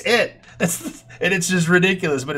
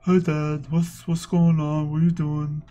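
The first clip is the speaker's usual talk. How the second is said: lightly voiced gloomy donkey begging for pets; Eeyore dog voice. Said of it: "I act like he's Eeyore".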